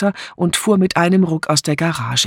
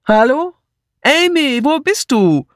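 high, searching call; 11 semitones higher, 3 dB louder than usual. Acted. Martha looking for Amy at home, calling out. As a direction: call through an empty house. Martha calls through the house.

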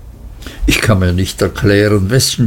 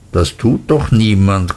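ordinary delivery, nothing marked

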